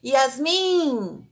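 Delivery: drawn-out name call; stretched calling tune, falling at the end; calling someone. Shown as a demonstration. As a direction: call out as if summoning a child. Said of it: "como se você estivesse chamando alguém".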